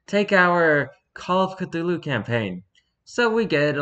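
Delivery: plain reading voice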